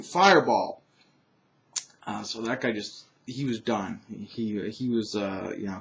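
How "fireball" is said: ordinary talk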